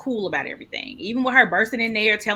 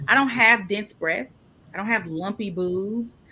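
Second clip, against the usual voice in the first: usual speaking voice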